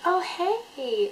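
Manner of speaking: high, drawn-out hello; imitated delighted greeting. Imitating Gina's friendly reaction.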